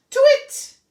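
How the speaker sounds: high, loud shouted twit; owl shout. Acted. Olive shouts her twit, hoping someone hears, loud and high. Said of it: "this time Olive shouted".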